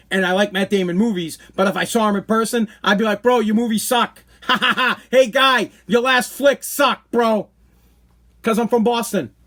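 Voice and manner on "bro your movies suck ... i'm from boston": put-on Boston-accented taunt, pitched up; Boston guy heckling. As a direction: jeer like a cocky Boston bro. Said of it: "That's how we talk".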